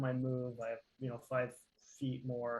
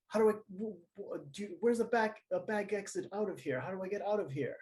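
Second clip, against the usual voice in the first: high, panicky plea for an exit; mild panic asking for a way out; frantic yell; 6 semitones higher than usual. Acted. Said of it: "I yell at, uh, Amric".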